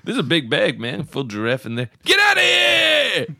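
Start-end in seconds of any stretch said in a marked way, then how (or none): 2.0-3.3 high, slow shout; mock shout. Playing with laundry, shouting at the imagined giraffe. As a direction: yell it at the pretend giraffe.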